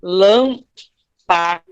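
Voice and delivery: lâmpada drawn out slowly, first syllable lâm stressed; slow teacher demo of lâmpada. Shown as a demonstration. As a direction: stretch out lâmpada, leaning on lâm. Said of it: "Por exemplo, lã".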